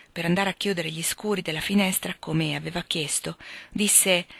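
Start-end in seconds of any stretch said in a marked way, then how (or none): none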